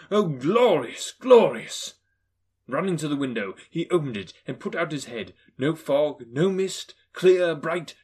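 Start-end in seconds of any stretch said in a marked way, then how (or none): 0.1-1.9 slow, louder, rising joy; exultant narration. A narrator's reading. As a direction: savour the morning.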